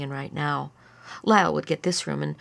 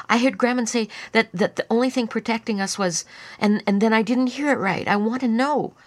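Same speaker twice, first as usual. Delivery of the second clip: put-on higher, louder insistent kid; agitated child; 5 semitones higher, 4 dB louder than usual. A narrator's reading. Lyle pressing Annie about Grandma, agitated. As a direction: stumble through it, demanding to know. Agitated boy demanding to know.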